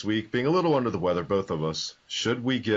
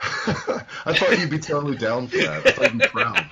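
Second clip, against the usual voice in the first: laughing, voice jumping higher and louder; 8 semitones higher, 4 dB louder than usual. Real, not acted. Laughing back at his co-host. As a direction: laugh it out in protest.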